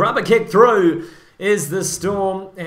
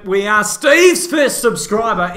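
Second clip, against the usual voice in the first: loud, rousing declaration; triumphant announcement; 3 semitones higher, 6 dB louder than usual. Real, not acted. Commentator announcing they subscribed to Steve's channel, loudly triumphant. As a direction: proclaim it like a big win.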